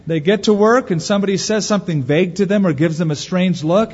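ordinary talk, nothing marked

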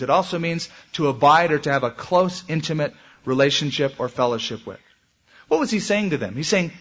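ordinary delivery

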